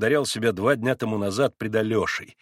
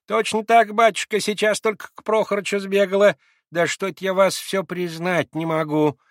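put-on high, louder old woman's voice; old servant woman; 8 semitones higher, 4 dB louder than usual. A narrator's reading. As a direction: answer the master as a humble old maid. Old servant answers Mitya.

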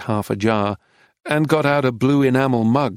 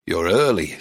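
Titus, lower and grave; a grave, lower old lawman; lower, serious welcome; 6 semitones lower than usual. Acted. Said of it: "said Titus gravely".

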